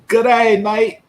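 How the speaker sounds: loud, high Aussie greeting; playful attempt at an Australian accent. Host greets a viewer from down under, hammed-up Australian accent. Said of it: "that's Australian, right?".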